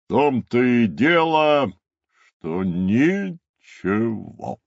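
emphatic, drawn-out character voice; rueful rhinoceros. Acted. Rhinoceros answers that he saw nothing, rueful character voice. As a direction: stretch the last word out slowly.

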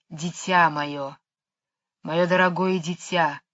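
tender hiss with whistling s. Acted. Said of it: "тихий, нежный, свистящий голос". (нежный свистящий змеиный голос)

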